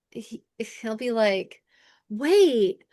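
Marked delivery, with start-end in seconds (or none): 2.1-2.8 high, drawn-out, loud teasing disbelief; mock incredulous husband. Husband to wife who admits being wrong, exaggerated incredulity. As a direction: stretch each word in gloating, hammed-up amazement. Voicing husband gloating at her.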